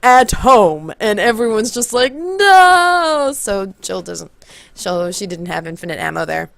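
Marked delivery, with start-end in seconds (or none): 0.0-0.9 lightly voiced loud announcement; game narrator. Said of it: "then you hear the narrator go". 2.1-3.3 loud, high drawn-out protest; mock dismayed cry. Everyone reacts in dismay to narrator.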